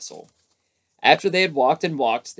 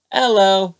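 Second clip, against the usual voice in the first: loud hello; 8 semitones higher, 10 dB louder than usual. A narrator's reading. Pooh greets Piglet.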